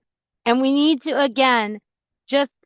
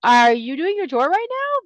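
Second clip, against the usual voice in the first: surprised; 4 semitones higher than usual. Retold or imitated. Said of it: "And I'm like".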